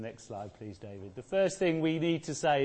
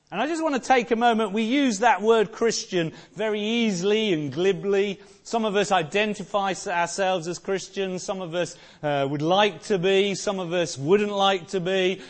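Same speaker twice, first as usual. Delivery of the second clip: fast, higher, louder sermon push; rousing the congregation on Easter morning; fervent preaching; 4 semitones higher, 7 dB louder than usual. Acted. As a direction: preach it out to the pews. Preaching with rising force.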